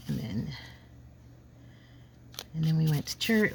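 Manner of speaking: usual speaking voice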